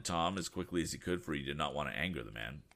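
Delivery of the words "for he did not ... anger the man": plain reading voice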